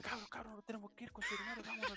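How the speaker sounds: high, frantic plea to clear out; acted-out panicked alarm; urgent panic. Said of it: "fui a la pieza de los cabros corriendo y dije".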